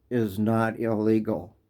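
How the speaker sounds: normal talking voice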